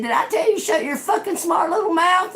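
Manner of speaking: angry scolding. Retold or imitated. Yelling at the girl.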